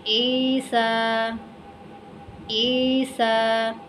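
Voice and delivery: ईसा very slowly, long ee and long aa; silent ya. Shown as a demonstration. Teacher models name twice, elongated recitation. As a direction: stretch ईसा evenly, no final y. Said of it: "ये वाला या भी नहीं पढ़ा जाएगा".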